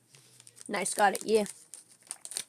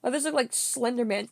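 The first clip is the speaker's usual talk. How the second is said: ordinary delivery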